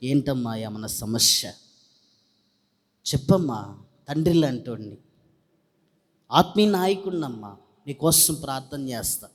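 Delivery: low, soft fatherly coaxing; gentle concerned pastor. Retold or imitated. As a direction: imitate a pastor tenderly drawing out her trouble. Pastor coaxes weeping woman. (తండ్రిలా మృదువుగా బుజ్జగిస్తూ)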